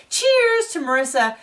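high, drawn-up imitation of a toast; chirpy mock cheers. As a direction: raise a glass in a put-on high voice. Acted toast to Marissa.

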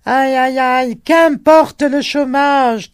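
high, loud cry into the wind; old man crying out against the wind; old man shouting into wind. A narrator's reading. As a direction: shout over the gale like an old peasant. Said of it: "cria le vieux contre le vent".

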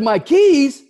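high, loud, laughing disbelief; incredulous. Real, not acted. Manager recalling being fired, laughing disbelief. Incredulous at losing his job.

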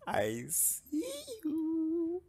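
high, drawn-out sing-song on the last word; creepy playful stalker. Pretend. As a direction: taunt them as a playful stalker. Killer playfully stalking survivors.

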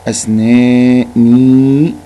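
أسناني with the long vowel drawn out very slowly and loud; stretched vowel demo. Shown as a demonstration. Teacher showing pupils the long vowel in أسناني, drawn out. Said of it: "عايز مد بالياء".

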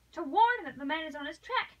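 high, urgent, desperate insistence; almost savage energy. A narrator's reading. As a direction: plead fiercely to be allowed to go. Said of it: "almost savage en-, energy".

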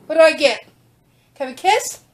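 usual speaking voice

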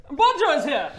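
very high, loud announcement; excited call upstairs. Acted. Young woman tells Roger his son arrived, high shout. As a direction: call it up the stairs.

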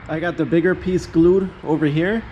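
ordinary talk

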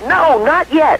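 high-pitched, mildly exasperated refusal; irritated. Acted. Husband holding his wife off, a little irritated. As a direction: put the wife off with acted irritation. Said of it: "getting a little bit irritated".

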